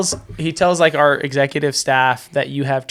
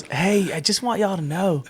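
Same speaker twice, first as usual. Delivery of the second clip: put-on Southern accent; 4 semitones higher, 4 dB quieter than usual. Imitating a friend's phone call, mistakenly Southern accent. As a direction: slip into an accidental Southern accent. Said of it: "I don't know why I went Southern".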